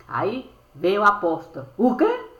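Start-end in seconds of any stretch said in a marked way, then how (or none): 1.8-2.3 high, loud shocked squeal. Acted. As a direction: squeal in disbelief. Shocked exclamation.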